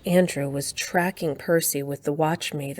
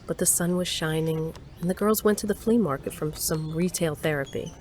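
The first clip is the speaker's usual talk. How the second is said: story narration; 4 dB quieter than usual. A narrator's reading. Story narrator scene link.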